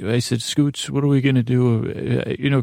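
usual speaking voice

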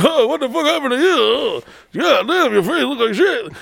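high, heckling comic impression; Rodney Dangerfield. Comic crashing a fancy country club, high heckling impression. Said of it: "Rodney Dangerfield".

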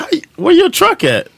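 high-pitched, puzzled call-out; acted questioning call. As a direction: ask it high, calling across a room. Acts out calling to his brother.

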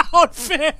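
giggling through the words; can't hold back the laugh. Real, not acted. Rehearsing a prank call with friends, laughing.

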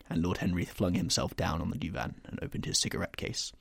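usual speaking voice

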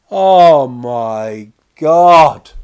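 slow, loud drawn-out oh my god; mock horror. Comedian reliving his embarrassment, loud and slow. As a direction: stretch it out in loud dismay. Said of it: "Can this be happening?".

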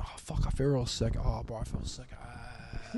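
soft, pathetic fake-sick moan; mock sick voice. Apprentice telling his boss he feels sick, a soft fake-sick moan. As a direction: lightly put on a poorly apprentice. Apprentice keeps faking sickness.

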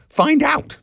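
high, loud snub; sharp retort. A narrator's reading. Miss Douce snubbing the nosy boots boy, loud sharp retort. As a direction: throw it back at full voice, pitched high. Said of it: "Miss Douce retorted".

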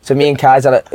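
ordinary delivery

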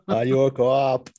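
loud, drawn-out mock Boston accent. Quoting The Departed to co-host in hammed-up Boston accent. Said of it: "it's just full of that accent".

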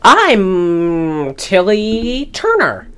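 over-the-top matronly introduction; imitating Shelley Winters' character. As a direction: ham up a grand lady introducing herself. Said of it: "And she's like".

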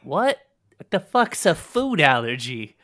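high, very loud comic outrage; incredulous pirate outburst. Acted. Pirate captive asked about food allergies, outraged disbelief.

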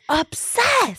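squeaky high-pitched obsessed; high squeal. Real, not acted. Echoing catchphrase, high squeal.

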